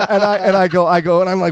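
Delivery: ordinary delivery, nothing marked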